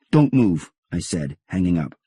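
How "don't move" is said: loud, high don't move; urgent order. Acted. Narrator urging the bookseller to stay, loud urgent order. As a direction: fire the order before he leaves.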